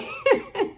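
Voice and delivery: high, loud burst of laughter. Real, not acted. Aunt telling nephew story, cracking up. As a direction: crack up at your own story.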